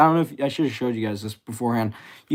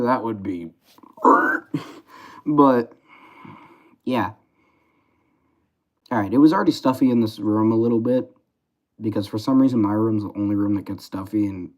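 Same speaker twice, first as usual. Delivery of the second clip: ordinary delivery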